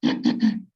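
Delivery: deliberate throat clearing; a quick, forced throat clear; demonstrated throat clearing. Shown as a demonstration. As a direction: show the class the patient's habitual throat clear. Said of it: "They will constantly clear the throat".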